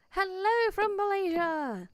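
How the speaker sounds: bright welcome with a falling "hello"; cheery greeting. Shown as a demonstration.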